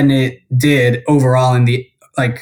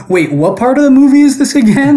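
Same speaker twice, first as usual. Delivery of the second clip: much higher, louder baffled question; confused; 10 semitones higher, 4 dB louder than usual. Retold or imitated. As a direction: sound totally lost in the plot. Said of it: "and I'm like".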